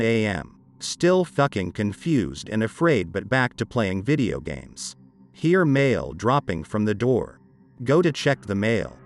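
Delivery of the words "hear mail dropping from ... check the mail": plain reading voice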